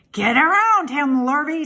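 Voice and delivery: lightly voiced farmer directing a helper; farmer giving orders. A narrator's reading. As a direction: call out orders to the hired man. Farmer orders his hired man.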